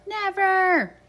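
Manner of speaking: a high, loud echoed Never; shouted. Acted. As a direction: throw it out like the villagers. Echoing the child's shout.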